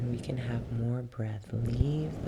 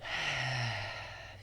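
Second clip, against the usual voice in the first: long audible exhale; cleansing sigh; 5 dB quieter than usual. Shown as a demonstration. Yoga teacher showing a cleansing breath, long sigh. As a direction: let the breath fall out in a slow sigh.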